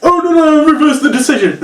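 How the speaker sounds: hammed-up panicky referee; mock referee. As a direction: squeak it out like a flustered ref. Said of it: "the referee goes".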